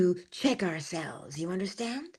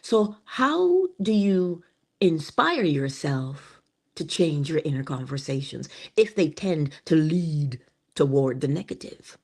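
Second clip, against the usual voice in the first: usual speaking voice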